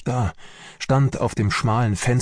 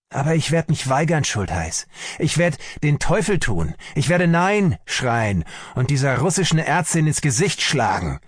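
higher-pitched, defiant outburst; shouting at someone; 6 semitones higher than usual. A narrator's reading. Army doctor refuses to obey the Russian doctor, shouting at him. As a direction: refuse angrily, right at the listener. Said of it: "schrie mich an".

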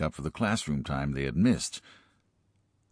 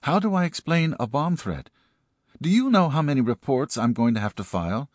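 high, exasperated complaining voice; flustered principal; 9 semitones higher, 5 dB louder than usual. Acted. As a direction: vent about the paperwork, worked up. Principal frets in story.

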